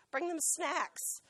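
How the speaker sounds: drawn-out slow advice; slow and deliberate; slow emphasis. Shown as a demonstration. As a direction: stretch each word for emphasis. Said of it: "stressed advice".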